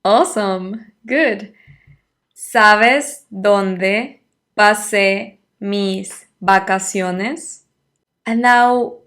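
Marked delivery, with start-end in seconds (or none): 2.4-7.6 very slow, careful Spanish question; slow model question. Shown as a demonstration. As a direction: stretch it out word by word for learners. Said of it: "So how would you say this time".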